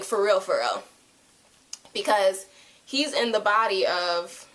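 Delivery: usual speaking voice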